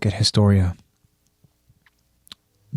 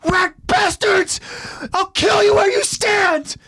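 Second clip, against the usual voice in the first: slow, drawn-out raspy scream, pitched very high; full-throated screamed threat; enraged scream; slower than usual. A narrator's reading. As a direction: scream the threat, stretching each word. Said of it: "Eren stops to scream out".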